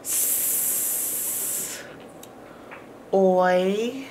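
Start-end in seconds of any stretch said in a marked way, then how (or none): 0.0-1.8 hissed S; "S-" as a long unvoiced hiss; just the sss, no voice; bare s sound. Shown as a demonstration. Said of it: "Three sounds".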